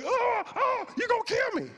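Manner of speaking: high, hammed-up cry of alarm; mock panic. Preacher mimicking a frightened reader of Revelation, mock panic. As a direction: overplay terror in a high voice. Mocking a fearful overreaction to scripture.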